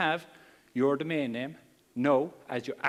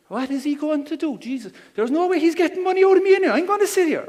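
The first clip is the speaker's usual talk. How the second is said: much higher, louder mock outrage at the speaker; scoffing sceptic; 11 semitones higher, 7 dB louder than usual. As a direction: play the scornful punter who won't pay a cent. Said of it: "sitting down here thinking".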